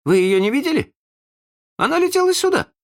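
high, urgent questioning; very high, agitated concern; worried. A narrator's reading. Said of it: "Питер забеспокоился".

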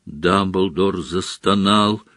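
plain reading voice

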